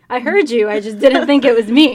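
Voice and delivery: loud, mock-innocent reply; loud retort. Friend answering someone who called her name, loudly. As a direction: call it out loud like you're answering across a room.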